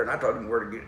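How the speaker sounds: usual speaking voice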